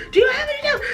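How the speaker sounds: high, squeaky quoted question; quoting the woman. Retold or imitated. Shop woman asking for towels, high and eager. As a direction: mimic her asking for towels. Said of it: "She's like".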